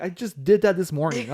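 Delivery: loud, high protest; retold exasperated yell. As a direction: protest it loudly, as if to the pastor. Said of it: "you're, like, yelling at the pastor".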